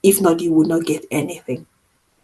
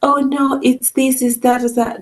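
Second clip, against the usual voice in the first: higher-pitched mock softening; mock sugarcoating; 7 semitones higher than usual. Friend refusing to soften the truth, mock sugarcoating. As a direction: make excuses in a high, placating voice. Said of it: "I'm not gonna say".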